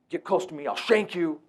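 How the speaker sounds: put-on a high, snapping kid's threat; mock threat. Runaway kid to staff member, high mock threat. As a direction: hiss it out like a cornered little rattlesnake.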